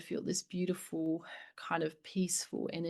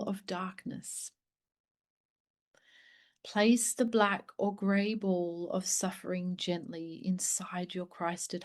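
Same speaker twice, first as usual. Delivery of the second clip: slow, hushed meditation voice, whispering the breath cues; guided meditation. Acted. Guiding listeners to breathe in their sisters' pain, hushed and slow.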